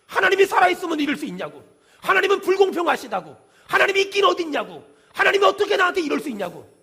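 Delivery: loud, high-pitched accusation; mock indignant outcry. Suffering people blaming God aloud, imitated indignant outcry. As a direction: hurl each complaint upward, forcefully.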